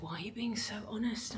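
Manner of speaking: hushed, breathy whisper of his question; mock whisper; whispered quote. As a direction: whisper it like a shocked aside. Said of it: "he's like".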